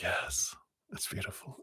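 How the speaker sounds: soft unvoiced whisper of the aunt; whispered spirit message. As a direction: breathe it out as a hushed whisper. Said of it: "She's like".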